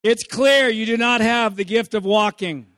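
loud, slow scolding parent voice. Retold or imitated. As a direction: lecture the toddler sternly. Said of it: "here's what, what the parent will say to the toddler".

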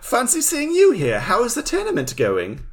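high, lilting mock-British voice; hammed-up British accent, starting way up high; posh British accent. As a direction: greet them like a posh Brit. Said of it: "his posh British accent".

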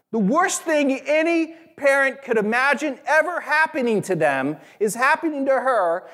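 high, impassioned preacher voice; preaching at full force. Acted. Pastor urging the congregation, impassioned preaching.

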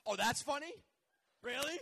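loud, high-pitched incredulous questions; mock offended. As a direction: act affronted that they laughed. Mock offence at audience laughter.

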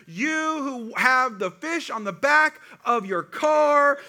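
loud, slow, rising sermon cadence; full-force preaching. Acted. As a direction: preach it hard and drawn-out.

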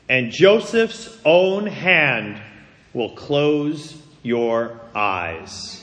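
slow, deliberate scripture reading; weighty and measured, pausing between phrases; solemn voice of God. Acted. Preacher reads God's promise to Jacob, solemn and slow.

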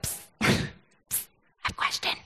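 voiceless whisper of a stranger leaning over. Retold or imitated. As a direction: hiss it under your breath, secretive. Stranger leans over on train.

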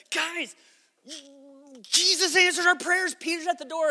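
high-pitched, overjoyed mock announcement; excited announcement. Servant girl running back to the praying group, excited. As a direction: burst out with thrilling news, high and loud.

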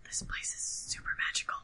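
conspiratorial whisper into the mic; giggly prank whisper. Real, not acted. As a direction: whisper it like a secret. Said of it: "Please stop whispering into the mic".

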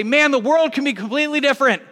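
eager hopeful outburst; hopeful exclaim. Retold or imitated. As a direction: sell the dream of a different world. Voicing hopeful generation.

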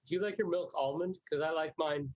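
ordinary delivery

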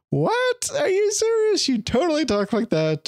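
shooting way up in pitch with disbelief; mock astonished, high squeal of disbelief; incredulous. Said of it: "Melissa and I were like".

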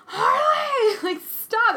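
high laughing cry of the name; laughing shout. Retold or imitated. Mom shouting at her baby through laughter. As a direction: yell the name through laughter, then stop.

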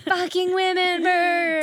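high, slow mock fury; mock outrage. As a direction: rant at the birds, hammed up.

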